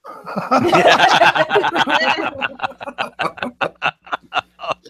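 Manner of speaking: real helpless cackling; long laughing fit. Real, not acted. Friends crack up at a crude joke, long real laughter.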